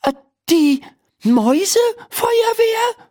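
high, halting disbelief; stammering. A narrator's reading. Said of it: "stammelt Laura". (hoch und stockend gestammelt)

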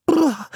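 high, chattering shiver sound; a girl's high brr from the icy water. A narrator's reading. Anne wading into cold water, high brr. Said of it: "machte Anne".

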